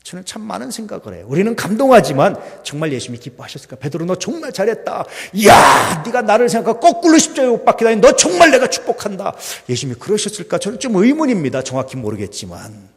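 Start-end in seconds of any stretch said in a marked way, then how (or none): 3.8-9.3 lightly voiced Jesus, higher and louder, with a burst on 이야; hammed-up praise from a pretend Jesus; mock Jesus praising. Pastor imagining Jesus praising Peter, mock cheering.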